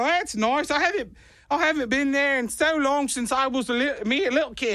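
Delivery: high, wandering put-on Australian accent; fake Australian accent. Husband bluffs about being away from Sydney, wobbly fake Australian accent. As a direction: fumble along in a badly faked Australian accent. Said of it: "Where are you from now?".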